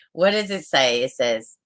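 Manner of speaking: ordinary talk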